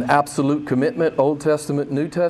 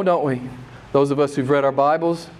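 ordinary delivery

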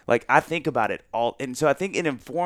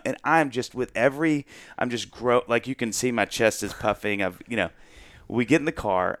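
ordinary delivery

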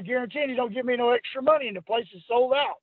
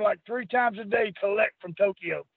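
usual speaking voice

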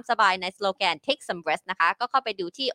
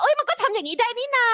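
very high-pitched "oh, so you can!"; acted surprised realisation; 12 semitones higher than usual. Host voices viewers discovering a new option, surprised. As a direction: pitch way up, mock astonishment.

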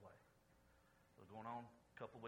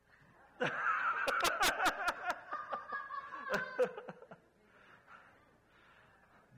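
loud laughter; 13 dB louder than usual. Real, not acted. Pastor laughs at own punchline.

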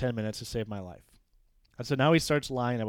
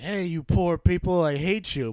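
higher, louder, slower sneering jab at the poor; mock hateful taunt; 6 semitones higher, 8 dB louder, slower than usual. A headmaster imagined sneering at poor parents, mock hateful. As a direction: ham up a snobby villain spitting contempt. Mock villain quote, acted.

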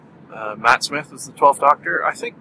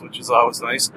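ordinary delivery

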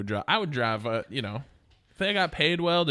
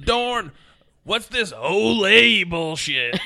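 a louder, higher, slow mock-outraged baseball player; movie quote impression; 7 semitones higher, 8 dB louder, much slower than usual. Trading Major League quotes, doing the outraged player. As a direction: drag out the indignant line like the movie character.

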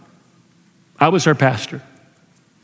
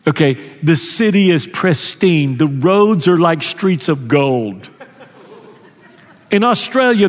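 ordinary talk

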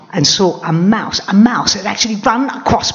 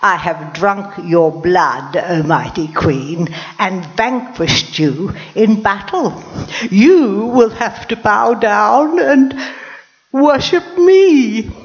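boastful insect; 4 semitones higher, slower than usual. Acted. Tiny insect boasting to the lioness queen, pompous and building. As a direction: puff up and crow, slowly, building to worship me. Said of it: "had the cheek to say to me".

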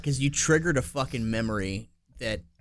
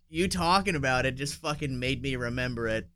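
normal talking voice

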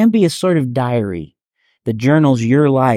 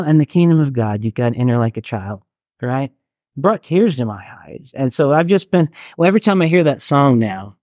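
ordinary delivery

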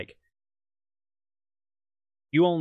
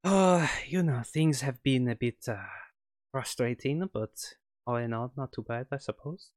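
put-on light on-and-off French accent as the villain; a French scientist, accent slipping in and out. GM as French ex-colleague on the phone, light French accent. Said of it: "excuse my terrible French accent".

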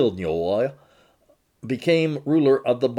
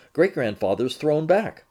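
ordinary delivery

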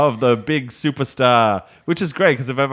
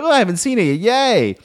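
higher, chirpy "Yay"; mock cheery comment; 9 semitones higher, 3 dB louder than usual. Commenters on his tweet, mock cheery. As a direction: chirp like an eager fan. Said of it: "people like".

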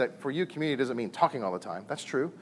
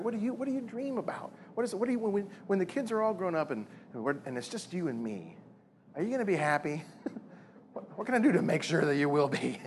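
tender asking; 5 semitones higher, 4 dB quieter than usual. Pretend. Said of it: "he can ask her the same question".

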